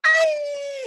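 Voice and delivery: high, loud comic wail; exasperated cry. Acted. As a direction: burst out at the noise.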